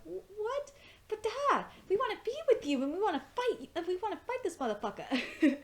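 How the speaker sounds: high, fast mock girly pleading; whiny protest to dad; daughters' protest. Mimicking daughters begging their father to stay, high and whiny. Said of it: "the daughters are like".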